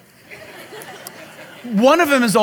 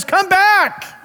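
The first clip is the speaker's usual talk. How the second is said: calling back; 8 semitones higher than usual. Retold or imitated. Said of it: "the other one is".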